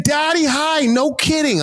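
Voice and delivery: thick Boston surprise; Boston accent. Retold or imitated. Driver delighted, Boston accent. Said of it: "switch accents quick".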